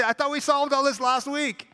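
high exasperated complaint. Retold or imitated. Someone exasperated the old fight is back. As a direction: whine it in frustration.

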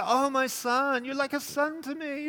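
high, slow sugary mimicry; mocking Saul. Preacher mimicking Saul's sugary fake affection. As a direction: ham up the fake sweetness.

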